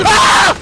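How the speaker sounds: high, loud scream; startled yelp. Real, not acted. Gamer startled mid-sentence on finding the key, yelping. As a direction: break off into a sudden scream. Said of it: "Gagi ka, nagulat ako".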